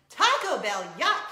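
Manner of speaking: playful loud disgust; mock disgust. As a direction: bark out a hammed-up yuck. Mocking disgust at Taco Bell.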